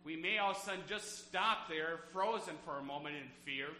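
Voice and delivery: full-force preaching. Acted. Pastor preaching against fear to his flock, fervent and loud. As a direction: drive God's promise home to the pews.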